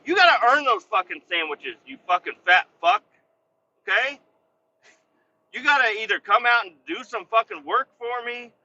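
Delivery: loud, high retold rant at coworkers; angry rant. Telling off the crew over a sandwich, loud angry rant. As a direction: yell it at the lazy crew.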